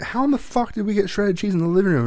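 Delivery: high, disbelieving exclaim; incredulous outburst. Retold or imitated. Dad retelling finding cheese in the living room, incredulous. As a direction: ask it like you can't believe the mess.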